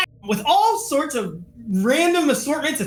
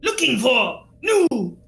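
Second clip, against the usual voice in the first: little creature merchant, higher swooping pitch; Mimips; 4 semitones higher than usual. Acted. Trapped merchant explaining how he got stuck, creature voice. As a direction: tell it in an animated critter voice.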